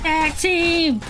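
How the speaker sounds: high-pitched shouted battle call; high rallying shout; excited call-out. Real, not acted. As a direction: shout it out high, mid-fight. Calling out during in-game fight.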